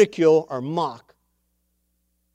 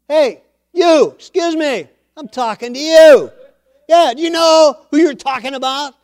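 much higher and louder, challenging the giant; young David shouting down the Philistine, high and loud; defiant shout; 7 semitones higher, 7 dB louder than usual. Retold or imitated. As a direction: call out the bully who insulted your friend. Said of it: "stepped up and said".

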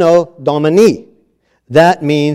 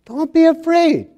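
high, lifted angel reassurance; hammed-up high heavenly plea; 11 semitones higher, 3 dB quieter than usual. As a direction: rise up into an otherworldly angel tone. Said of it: "they have to say".

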